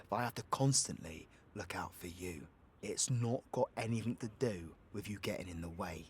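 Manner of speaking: low, soft pleading explanation; earnest, hushed. Acted. Warning partner they could get hurt, hushed and earnest. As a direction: keep it low and soft, reasoning with a loved one.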